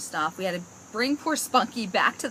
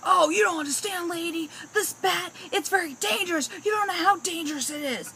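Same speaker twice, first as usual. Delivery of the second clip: much higher, louder alarmed warning; panicky pest guy; 10 semitones higher, 3 dB louder than usual. Retold or imitated. Pest control man warns the customer about a dangerous bat, high and alarmed. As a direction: oversell the danger like a scare-mongering salesman. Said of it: "he goes to me".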